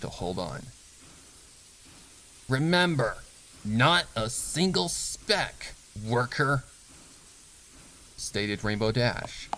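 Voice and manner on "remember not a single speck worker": slow, higher, drawn-out warning; menacing reminder. Acted. Boss leaving the worker with a final threat, slow menace. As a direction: stretch it out as a threat.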